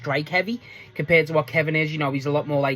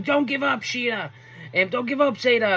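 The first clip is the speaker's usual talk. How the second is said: higher mock cheering from ringside; imitating Christopher Daniels; 5 semitones higher than usual. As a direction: call it out like a coach at ringside. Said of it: "you can hear him throughout the match going".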